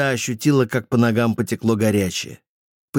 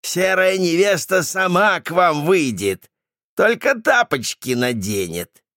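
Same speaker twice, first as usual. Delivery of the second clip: mocking taunt; 8 semitones higher than usual. Jackal man cackling taunt.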